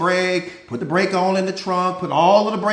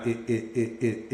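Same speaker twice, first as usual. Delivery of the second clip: ordinary delivery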